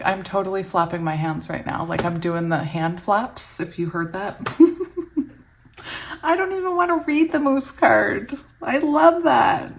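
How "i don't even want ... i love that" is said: high, gushing excited voice; giddy delight. Real, not acted. Reader loves her card too much to move on, giddy. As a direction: gush it high, stretching out the delight.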